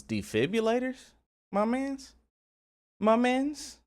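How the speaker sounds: high, squeaky disbelief; can't believe what he's seeing; incredulous. Real, not acted. Incredulous at officers' rough help.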